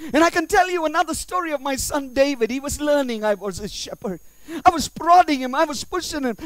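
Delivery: high, driving sermon voice; full-force preaching. Acted. God telling of his son David, high impassioned preaching. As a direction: preach the story with rising force.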